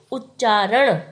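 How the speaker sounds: ordinary talk, nothing marked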